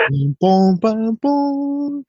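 high, drawn-out announcement jingle; mock ding-dong broadcast tone; imitated PA chime. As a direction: sing out a public-address chime slowly.

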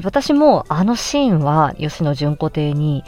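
usual speaking voice

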